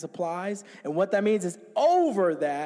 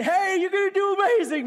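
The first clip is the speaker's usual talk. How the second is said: high-pitched mock excitement with laughter; hammed-up cheering on; excited hype; 11 semitones higher, 4 dB louder than usual. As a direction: gush in a high, put-on voice. Acting out hyped parting words.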